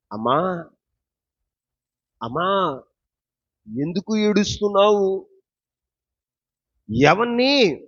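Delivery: loud, spaced-out question to a weeping woman; preached divine question. Retold or imitated. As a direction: voice Jesus's question from the pulpit, projecting it. Preacher voices Jesus questioning Mary.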